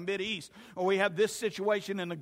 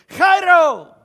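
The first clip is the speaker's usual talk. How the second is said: chairo shouted much higher and louder with spit; spitting shout; 10 semitones higher, 18 dB louder than usual. Retold or imitated. People greeting each other, shouting chairo with spit. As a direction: bellow the greeting, spraying the listener. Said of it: "They would look at each other and go".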